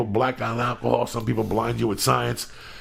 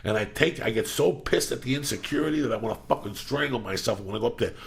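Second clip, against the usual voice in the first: normal talking voice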